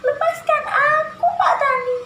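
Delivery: very high, loud pleading squeal; high-pitched, wailing mouse-deer voice; Kancil. Shown as a demonstration. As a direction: squeak out a desperate plea like a tiny trapped animal. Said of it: "Gini ya suaranya si Kancil".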